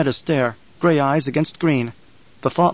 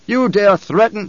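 higher, louder, slow outrage; furious challenge; 6 semitones higher, 4 dB louder, slower than usual. Acted. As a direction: hurl the challenge back. Emperor outraged at threat.

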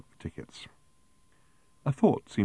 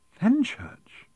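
very high, astonished echo; incredulous. A narrator's reading. Arthur hearing Fenny's real name, incredulous. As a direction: squeal the name in disbelief.

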